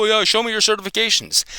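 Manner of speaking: high, sneering mimic of a smug trainer; mock their challenge with a sneer; mocking quote. Mimicking trainers who demand his certificates, high and sneering. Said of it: "dog trainers come up to me and go".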